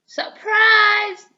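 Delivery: ordinary delivery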